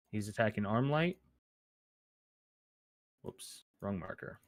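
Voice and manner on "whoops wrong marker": muttered aside. Real, not acted. Mutters to himself about a mistake.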